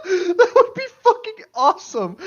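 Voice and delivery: loud, high, slow words through laughter; laughing delight. Real, not acted. Friend imagines a Dementor Animagus, laughing loudly. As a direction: gush it out while laughing.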